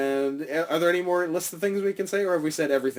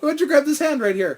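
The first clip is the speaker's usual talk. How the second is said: taunting; 11 semitones higher than usual. Acted. Teasing the officer.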